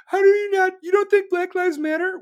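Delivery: high, loud mock outrage; outraged commenter.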